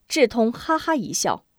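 plain reading voice

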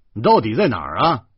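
loud, irritated demand; flustered anger. A narrator's reading. Embarrassed driver glares and demands the place, annoyed. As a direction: snap it out loudly. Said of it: "周胖子有点恼羞成怒了".

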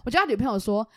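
usual speaking voice

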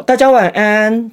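slow, high, drawn-out farewell; joking goodnight. Retold or imitated. Patient joking to the surgical team, a slow high goodnight. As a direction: play it as a cheeky sign-off. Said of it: "本来想要搞个笑".

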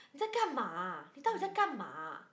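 sister scolding. Retold or imitated. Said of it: "他就这样欸".

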